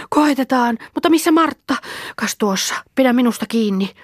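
put-on child in danger; anxious child. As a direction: call out for her sister. Girls in water.

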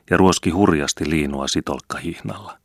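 plain reading voice

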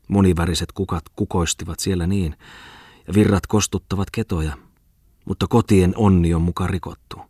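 ordinary delivery, nothing marked

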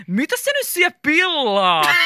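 loud, high, drawn-out teasing; holding back laughter. A narrator's reading. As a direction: tease slowly with laughter in your throat. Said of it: "sanoi Mika naurukurkussa". (naurukurkussa, venytellen)

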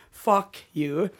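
normal talking voice

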